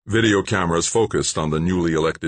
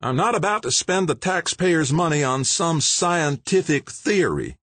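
higher, brusque interruption; mayor's voice; 12 semitones higher than usual. Acted. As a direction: cut him off impatiently. Mayor refuses spending.

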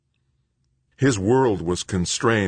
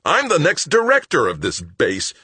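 high, loud pompous roar; 11 semitones higher, 6 dB louder than usual. Acted. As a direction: bellow your rank at him. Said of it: "Greg roared".

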